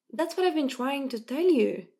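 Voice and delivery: finally, someone gets it; excited. A narrator's reading. Mo to Isra after she admits his point, excited. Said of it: "Mo said excitedly".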